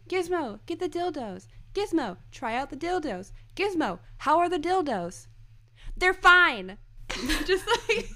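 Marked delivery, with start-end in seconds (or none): 0.1-5.3 high-pitched calling out, falling at each end; Guillermo impression. Fan voicing Guillermo asking Gizmo, high-pitched calling. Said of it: "was Guillermo saying". 5.9-6.8 high, loud retort; mock outburst. Fan quoting the answer to Guillermo, loud high outburst. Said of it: "just the delivery".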